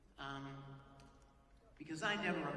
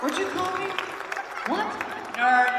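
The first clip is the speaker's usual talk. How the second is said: high, loud mock outrage; mock offended; 13 semitones higher, 15 dB louder than usual. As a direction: pretend to be insulted by the crowd.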